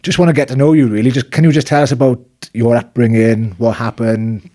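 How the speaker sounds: usual speaking voice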